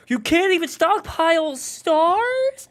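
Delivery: high, slow, disbelieving whine; high incredulous take. Retold or imitated. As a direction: stretch it out in shrill disbelief. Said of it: "I delivered it in several ways".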